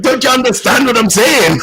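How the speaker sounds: high, loud mock Scottish brogue; Scottish priest. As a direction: impersonate the thick Scottish accent, exasperated. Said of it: "a Scottish priest up there that I could barely understand".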